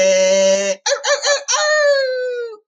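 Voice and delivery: shrill [name] ow; high falsetto yelps. Acted. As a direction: squeal it in falsetto.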